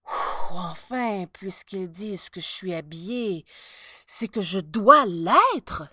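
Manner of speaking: sighed, hesitant self-persuasion; doubtful resignation. A narrator's reading. Emperor deciding he must be dressed, unconvinced. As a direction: talk yourself into it, leaning hard on the ending.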